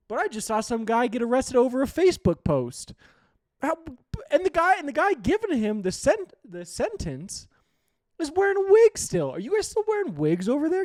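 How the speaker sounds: loud, high, disbelieving rant; incredulous shout. Real, not acted. Vlogger shouting at European commenters in disbelief. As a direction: yell it in outraged disbelief.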